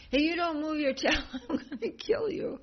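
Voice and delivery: high, loud threat breaking into laughter; hammed-up threat through a laugh; laughing mock threat. Bhima threatening the monkey, high and laughing.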